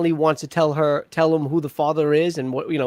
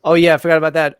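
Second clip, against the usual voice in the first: ordinary delivery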